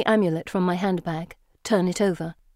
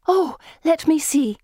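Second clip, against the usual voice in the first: put-on eager girlish; 9 semitones higher, 4 dB louder than usual. A narrator's reading. Pippa eager.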